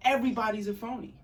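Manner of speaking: high, loud, quick proclamation; punchy theatrical outburst; emphatic declaration. Acted. Acted quote from the book.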